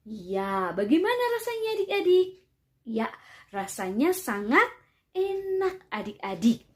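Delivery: put-on lively sing-song questions for kids; reading to a toddler. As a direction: coax little children to answer. Quizzing children about grapes.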